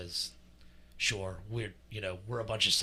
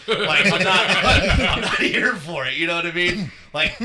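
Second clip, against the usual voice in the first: laughing and loud, pitched way up; 6 semitones higher, 10 dB louder than usual. Real, not acted. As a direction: crack up while ranting. Laughing excited rant.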